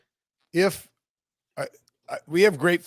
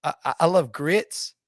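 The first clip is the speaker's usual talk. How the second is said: put-on phony Southern accent; Brian Kelly; 2 semitones higher than usual. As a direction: imitate a coach faking Southern charm in an interview. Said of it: "just faking his Southern accent the whole way through".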